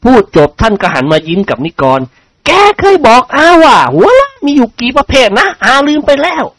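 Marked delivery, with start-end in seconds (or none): 2.2-6.4 high-pitched aunt's voice; lady's high, questioning voice; inquiring aunt character. Acted. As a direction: play an aunt quizzing her nephew. Lady questions her nephew.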